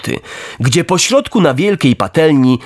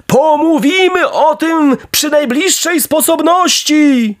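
grateful dog; 10 semitones higher than usual. A narrator's reading. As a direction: call out thanks in a high, stretched voice. Rescued dog calls thanks to puppet.

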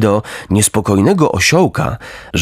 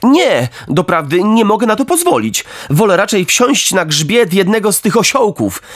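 put-on higher, firm boyish voice; raised, resolute puppet voice; earnest puppet Pinokio; 8 semitones higher than usual. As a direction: stand your ground like a good boy. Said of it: "zawołał Pinokio".

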